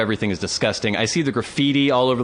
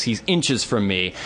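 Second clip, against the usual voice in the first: usual speaking voice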